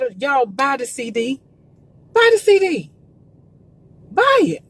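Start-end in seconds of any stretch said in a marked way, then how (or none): 2.1-4.6 emphatic urging. Real, not acted. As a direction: drive it home to the viewers. Urging viewers to buy CDs.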